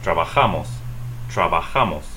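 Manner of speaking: "Trabajamos" as tra-ba-HA-mos; Spanish "Trabajamos", third syllable stressed and falling; stress next-to-last syllable. Shown as a demonstration. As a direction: lean on "ja" in "Trabajamos". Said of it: "stress the next to the last syllable".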